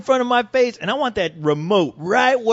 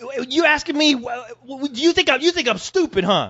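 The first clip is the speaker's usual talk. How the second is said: higher, indignant rant; mock tirade; 6 semitones higher than usual. Husband ranting at his wife about the bill, mock indignant and higher. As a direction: rant back at her, offended.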